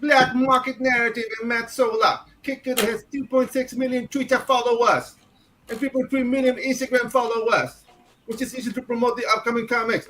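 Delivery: high-pitched mock Jamaican accent reading. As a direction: read the news in a Jamaican accent. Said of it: "I haven't heard a Jamaican in years".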